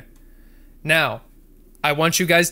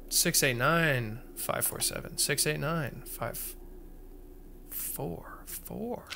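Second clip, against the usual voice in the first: muttered code; 10 dB quieter, slower than usual. Shown as a demonstration. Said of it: "repeats code to self".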